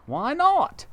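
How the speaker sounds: monkey pleased with the tree house; Mugglewump. Acted. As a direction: ask it innocently. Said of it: "asked Mugglewump".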